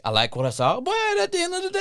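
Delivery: ordinary talk, nothing marked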